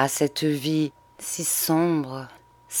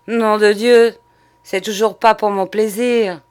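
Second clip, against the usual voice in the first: loud, higher, harsh snarl of a streetwalker; rough, nasty voice; 5 semitones higher, 7 dB louder than usual. Acted. Prostitute snaps back at the questioning man, rough and nasty. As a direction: spit it out with raw bitterness. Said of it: "la voix changée, rauque, méchante".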